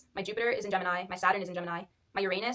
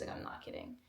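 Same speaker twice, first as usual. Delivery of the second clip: whisper; 14 dB quieter than usual. Real, not acted. Aside about sleeping a lot.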